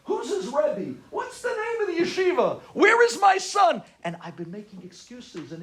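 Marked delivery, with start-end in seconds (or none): 0.1-3.8 high, mounting interrogation; going crazy. Retold or imitated. Uncle relaying father's questions about the son, frantic high demands. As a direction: build the questions to a shout. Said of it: "My uncle was going crazy on me".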